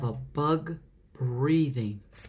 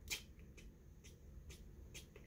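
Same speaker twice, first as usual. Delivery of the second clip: soft ticking; 27 dB quieter than usual. Shown as a demonstration. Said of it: "it goes".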